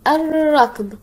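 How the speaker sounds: الركض with a long rolled r and no l; doubled r. Shown as a demonstration. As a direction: model "ar-rakd" for الركض, holding the trill. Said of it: "انطق الكلمات مرة أخرى".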